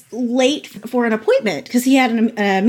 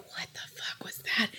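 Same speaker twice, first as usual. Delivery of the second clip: hushed, voiceless whisper; 19 dB quieter than usual. Retold or imitated. Coworkers whispering in shock after the angry client left. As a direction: whisper it so nobody outside hears.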